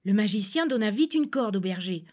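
usual speaking voice

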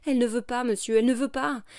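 high, loud pleading cry. A narrator's reading. Old maid on her knees begging the officer, loud cry. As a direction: beg at the top of her voice.

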